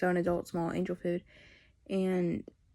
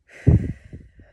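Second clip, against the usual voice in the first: long loud unvoiced sigh; heavy sigh; 15 dB louder than usual. Real, not acted. Telling viewers about her dad, a heavy real sigh. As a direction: let out a big weary breath.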